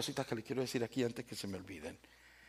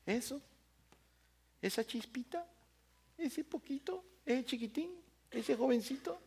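mocking belittling; 11 semitones higher, 7 dB quieter than usual. People belittling young David.

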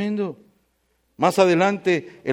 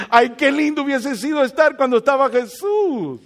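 wistful exclamation; 7 semitones higher than usual. Retold or imitated. Preacher quoting his own wish to the congregation, wistful. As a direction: imitate your own wishful sigh of admiration. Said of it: "en ocasiones yo he dicho".

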